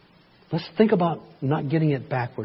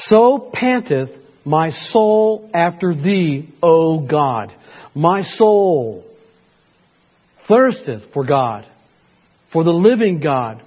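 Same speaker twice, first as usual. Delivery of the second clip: slow, louder, fervent Bible reading; impassioned scripture reading; 3 semitones higher, 4 dB louder, slower than usual. Acted. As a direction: pour out the psalm like a prayer.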